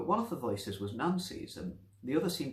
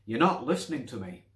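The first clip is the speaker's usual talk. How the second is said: loud, pleading cry; desperate; 2 semitones higher, 9 dB louder than usual. Acted. The other voice pleading with Nancy, desperately. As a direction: make him hear you, desperate. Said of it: "the other voice said desperately".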